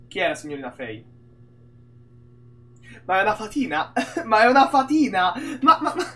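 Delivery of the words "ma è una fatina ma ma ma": real laughter breaking into the words; incredulous, dissolving into laughter; amused disbelief. Real, not acted. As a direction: repeat it laughing, then stutter into giggles.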